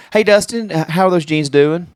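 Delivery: high, chirpy sales-assistant voice; imitated store clerk. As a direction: mimic a clerk checking on the fitting room. Voices store clerk at dressing room.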